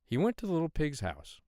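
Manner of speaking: ordinary delivery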